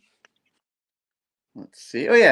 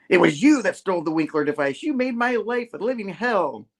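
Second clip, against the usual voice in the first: raised voice climbing much higher; mock outraged accusation; 7 semitones higher than usual. Confronting the device thief, hammed-up rising accusation. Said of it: "But he's all".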